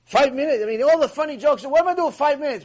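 rabbi's hammed-up indignation; mock outrage. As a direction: act out exasperated disbelief, loud and high. Acted rabbi's disbelief at time limit.

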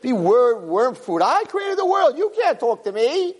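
high-pitched, scornful mock tirade; vengeful God. God telling a girl she can't talk to Him, mock vengeful. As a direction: play an indignant deity putting a human down. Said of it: "so mean and evil and vengeful".

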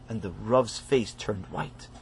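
soft, low and slow; hushed dramatic. A narrator's reading. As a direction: drop to a hush. Narrator drops for shock.

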